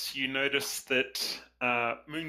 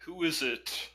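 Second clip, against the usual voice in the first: quavering voice; 4 semitones higher than usual. A narrator's reading. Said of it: "a quavering voice says".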